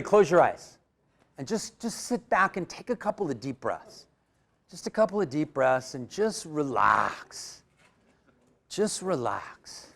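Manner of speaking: soothing lead-in with long, stretched words; calming guidance. Real, not acted. Leader guiding audience into relaxation.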